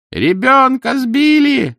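very high, drawn-out shout; woman's scream. A narrator's reading. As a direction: scream the alarm over a crowd. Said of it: "закричала женщина".

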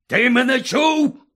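shouting. Acted. Uncle spitting into Harry's face, furious yell. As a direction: roar it right into his face. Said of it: "кричав дядько Вернон".

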